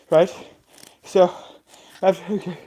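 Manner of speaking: usual speaking voice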